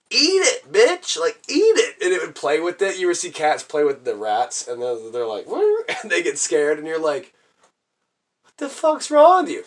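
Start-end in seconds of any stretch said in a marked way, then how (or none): 0.1-2.0 high, loud mock yell; egging on a useless pet, voice shooting up; exasperated urging. Kid to the cat ignoring a rat, exasperated yelling. 5.5-5.8 quick high-pitched "woo"; imitated cat noise, leaping way up; high playful whoop. Imitating cats toying with rats, playful whoop. 8.4-9.6 high, loud, incredulous outburst; mock-yell it at a hopeless pet; exasperated scolding. Kid scolding the defective cat, exasperated.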